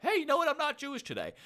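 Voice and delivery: high, jokey voice of a friend who woke up different; goofy high friend quote; mock friend voice.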